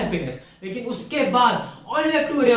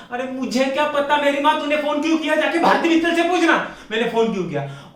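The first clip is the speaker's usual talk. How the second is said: higher, exasperated comic rant; hammed-up frustrated retort; acted exasperated outburst; 4 semitones higher than usual. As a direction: throw the question back, fed up. Acted retort in a phone quarrel.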